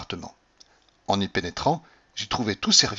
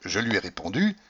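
usual speaking voice

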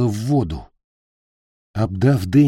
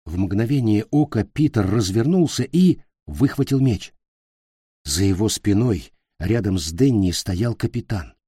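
ordinary delivery

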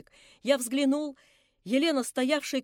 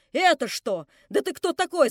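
shrieking; 4 semitones higher, 6 dB louder than usual. Acted. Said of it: "завизжала она".

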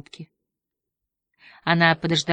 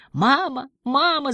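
put-on high wailing child calling for mum; a small kid howling for mama; bawling child; 10 semitones higher, slower than usual. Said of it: "заревел ребёнок". (высокий детский рёв)

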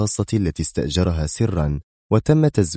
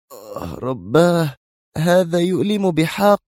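slow pained moan rising into a high voice; groan of pain; 10 semitones higher, slower than usual. Acted. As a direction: groan through the hurt, acted. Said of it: "سمعت من خلفها آهة ألم". (تأوّه من الألم)

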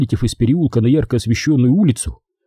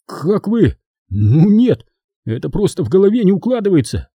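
blurted out; 8 semitones higher than usual. Acted. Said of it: "выпалил Натаниэль".

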